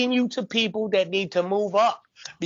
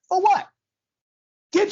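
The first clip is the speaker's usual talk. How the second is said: indignant shout; 12 semitones higher, 5 dB louder than usual. Real, not acted. Indignant retort.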